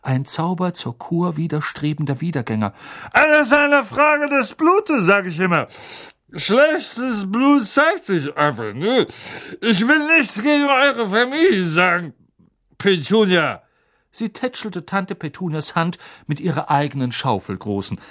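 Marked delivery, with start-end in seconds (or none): 3.1-13.6 loud, high, slow bossy aunt; Aunt Marge voice. A narrator's reading. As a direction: boom it out like a tipsy aunt.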